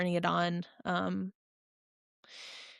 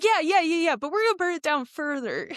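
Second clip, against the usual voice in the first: excited pacer; 8 semitones higher than usual. Retold or imitated. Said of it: "He's like".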